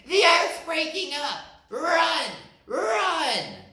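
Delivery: high, loud panicked shouting; high frantic rabbits; fleeing shout. Acted. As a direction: shout while running. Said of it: "running and shouting".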